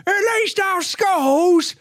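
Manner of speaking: mocking British TikTok jokes, high and loud; mock British accent. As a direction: sneer like a smug Brit.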